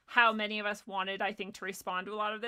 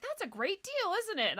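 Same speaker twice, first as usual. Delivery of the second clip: high-pitched, friendly shopper chit-chat; chirpy small talk; 12 semitones higher than usual. Retold or imitated. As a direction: make eager small talk with a stranger. Reenacting forced friendly small talk.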